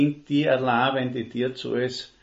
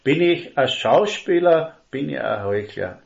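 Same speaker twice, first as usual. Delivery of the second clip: pointed question; 4 semitones higher, 7 dB louder than usual. Real, not acted. Preacher's pointed self-question.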